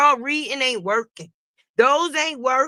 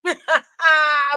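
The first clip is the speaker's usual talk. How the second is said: amused cackle; laughing; 3 semitones higher than usual. Real, not acted. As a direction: burst of real laughter. Laughs at own accent.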